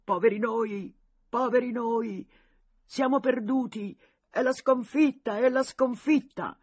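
high, loud mock wailing of defeat; sly fake panic. A narrator's reading. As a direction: cry out as if lost, but it's a trick. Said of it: "furbescamente gridavano". (finto panico gridato, alto e forte)

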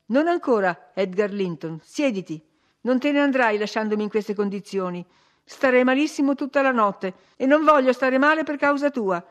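pleading demand, building to high and loud; insistent. A narrator's reading. Catherine, holding the handle, insists with rising heat that Edgar stay. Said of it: "insistette lei afferrando la maniglia".

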